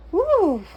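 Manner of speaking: high loud whoop of joy; excited whoop. Real, not acted. Reader cheering at the thought of rest, whooping. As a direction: let out a happy woo. Celebrating resting.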